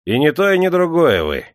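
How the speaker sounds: high, loud, ready assent; willing agreement. A narrator's reading. Clerk readily agrees with the gloomy peasant, willing. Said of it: "охотно согласился приказчик".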